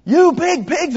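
high, loud mock-child yell; imitated little-sister scream. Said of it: "she screams at the top of her lungs".

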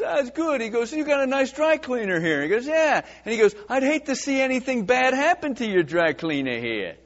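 a veiled threat dressed up as a compliment; mob threat. Acted. Mobster threatens dry cleaner.

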